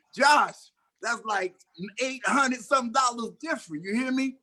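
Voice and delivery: telling Josh how big the pay jump was, excited; excited disbelief. Real, not acted. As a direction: marvel at the money. Marvelling at the pay difference.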